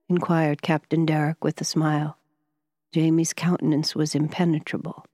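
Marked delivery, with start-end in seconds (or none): none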